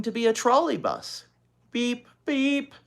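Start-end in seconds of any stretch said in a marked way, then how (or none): 1.7-2.7 high honking beep beep. Acted. Little girl honking along to the story. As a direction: honk like a trolley bus. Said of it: "honked Ramona".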